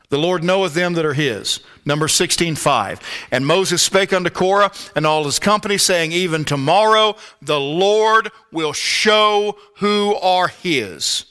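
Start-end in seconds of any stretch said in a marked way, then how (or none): 0.1-1.6 scripture reading. Real, not acted. Reads scripture aloud again. 3.3-11.2 scripture reading. Real, not acted. Reads Numbers sixteen aloud.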